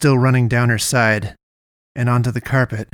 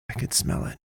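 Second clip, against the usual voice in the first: low unvoiced mutter under her breath; 10 dB quieter than usual. A narrator's reading. Girl muttering a parting jab before slamming the door. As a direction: mutter it so he barely catches it. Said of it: "She muttered".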